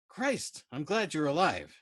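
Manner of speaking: high, loud burst of relief; relieved exclamation. Acted. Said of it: "he exclaimed".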